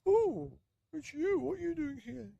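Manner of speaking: very high, loud oh; surprised old man. Acted. Old man finding the runaway cat, high surprise.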